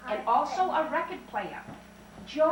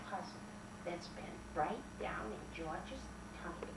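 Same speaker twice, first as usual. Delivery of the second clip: hushed reveal; 6 semitones lower, 15 dB quieter than usual. A narrator's reading. Whispered story punchline.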